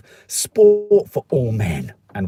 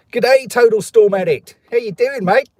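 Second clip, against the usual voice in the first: mock Australian greeting; 9 semitones higher, 7 dB louder than usual. Reading listener message, Aussie greeting.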